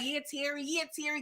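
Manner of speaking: hushed egging on. Real, not acted. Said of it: "I was like".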